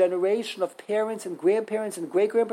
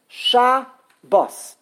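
syllable by syllable; 4 semitones higher, 6 dB louder than usual. Shown as a demonstration. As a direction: split it firmly: Sha, bos. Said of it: "two syllables".

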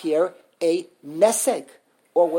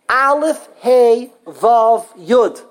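each letter hit louder; emphatic list; 3 semitones higher, 5 dB louder, much slower than usual. Shown as a demonstration. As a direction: tick off Aleph, Hey, Vav, Yud. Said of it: "listing weak letters".

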